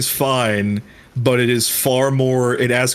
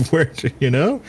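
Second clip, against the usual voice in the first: usual speaking voice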